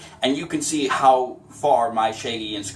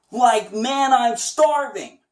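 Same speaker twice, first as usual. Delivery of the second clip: much higher, slow and drawn; higher again; 9 semitones higher, 4 dB louder, much slower than usual. Shown as a demonstration. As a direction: keep climbing the scale. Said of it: "see how high your voice can go".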